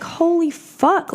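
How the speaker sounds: stunned revelation, drawn out; amazed realization. Retold or imitated. Watching open mic at UCB, slow awed realization. Realizing comedy can be workshopped.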